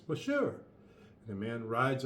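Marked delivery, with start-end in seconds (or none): 0.1-0.6 mocking sure. Invader shrugs off challenge.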